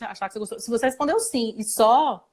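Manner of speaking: ordinary talk, nothing marked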